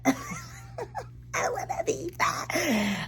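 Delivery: slow, breathy laugh turning to crying; half whispered, half sobbing; whisper-laugh through tears. Real, not acted. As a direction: let it crack between laugh and tears. Admitting wish after therapy.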